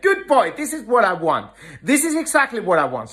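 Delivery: high, bright-spirited praise with a laugh. Shown as a demonstration. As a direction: cheer the dog on as he comes. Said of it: "I show a little bit more emotions".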